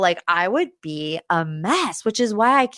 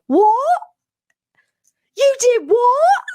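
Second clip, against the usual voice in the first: high, loud disbelieving shriek; hammed-up shock, pitched way up; mock shocked Lisa; 6 dB louder, slower than usual. Recapping Lisa hearing about the Halloween outfit, mock shock. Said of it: "And Lisa's like".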